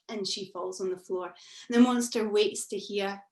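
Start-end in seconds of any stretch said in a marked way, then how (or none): none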